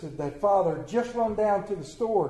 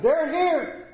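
much higher, louder relieved call; shouted; 10 semitones higher, 4 dB louder than usual. Acted. Mother shouting as family arrives, high and loud. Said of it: "opened the door and shouted".

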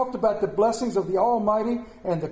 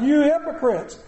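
ordinary delivery

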